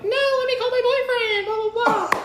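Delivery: high-pitched, rushed mock insistence; high retold outburst, words tumbling out; mock protest. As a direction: blurt it fast and very high, hamming it up. Acted comeback to shocked friends.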